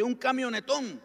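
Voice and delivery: high-pitched astonished finish; incredulous question. Retold or imitated. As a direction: imitate a baffled church brother. Astonished brother finishes his question.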